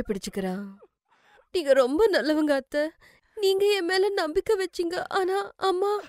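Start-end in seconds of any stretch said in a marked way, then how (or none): 1.5-6.0 high-pitched, sobbing gratitude; crying. Acted. Daughter-in-law hugging the mother-in-law who trusted her, crying. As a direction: thank her through tears. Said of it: "Simran அழறா".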